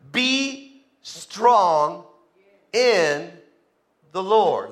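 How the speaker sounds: "Be strong" stretched out, each word held; deliberate, weighty pauses after "strong" and "in"; emphatic slow delivery. Real, not acted. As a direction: draw out "Be" and "strong", pausing before "in". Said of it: "Let me say that again".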